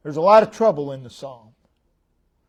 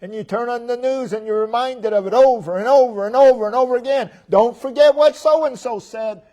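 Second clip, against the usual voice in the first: 1 mock agitated; 7 semitones higher, 6 dB louder than usual. Mocking news-stirred upset.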